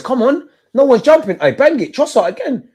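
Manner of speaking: high, loud cries at the screen; excited shouting. Real, not acted. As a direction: yell at the corner kick. Shouting at set piece.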